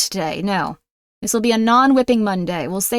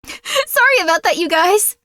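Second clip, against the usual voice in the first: laughing apology; 8 semitones higher than usual. Real, not acted.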